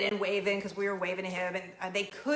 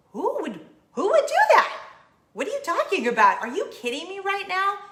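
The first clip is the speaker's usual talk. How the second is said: much higher and louder, faster, in disbelief; incredulous outburst; 8 semitones higher, 7 dB louder than usual. Retold or imitated. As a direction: blurt it out, stunned. Incredulous at the complaint.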